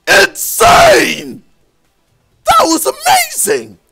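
high, loud burst of amazement; thrilled shouting. Real, not acted. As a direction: explode with awe. Raves after finishing a run.

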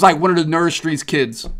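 ordinary talk

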